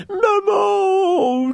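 very high, long mock wail; bébé en détresse. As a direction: cry out for mum like a little calf. Bébé taureau pleure sa mère. (longue plainte aiguë de bébé)

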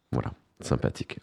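whispered, dry sarcasm; sarcastic whisper. Real, not acted. Radio host whispers sarcastically about the manosphere term. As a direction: breathe it out as an ironic aside. Host's sarcastic aside after quiz answer.

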